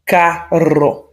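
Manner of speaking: carro with a strong trilled rr; carro with a rolled rr. Shown as a demonstration. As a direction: roll the rr in carro.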